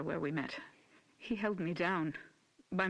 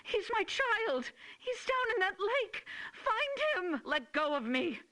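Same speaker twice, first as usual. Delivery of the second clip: hysterical screaming; 12 semitones higher than usual. Acted. Mother struggles against officers.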